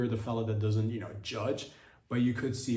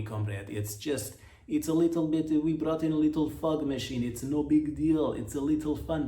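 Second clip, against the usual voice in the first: hammed-up Russian accent, bouncy and higher; 3 semitones higher than usual. Cosmonaut Sergei reassuring the American in a hammed-up Russian accent. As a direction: play a smug Russian cosmonaut brushing it off. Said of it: "looking over there at Sergei, and he's all".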